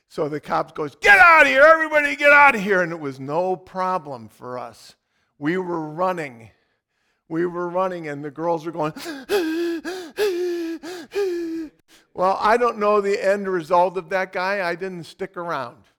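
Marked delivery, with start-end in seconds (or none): 1.0-2.8 loud, higher, fast order; cop shouting. Retold or imitated. Cop orders crowd away. 9.0-11.7 high, startled gasp; imitated gasp. Scared girls gasping.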